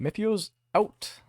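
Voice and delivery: high, slow theatrical sign-off; grand sign-off. Acted. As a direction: sign off like a showman. Sign-off of a magical figure.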